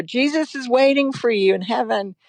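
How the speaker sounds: sing-song it like a doting movie mom; high lilting imitation of a mother; imitating the movie mother. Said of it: "like the mother in the movie".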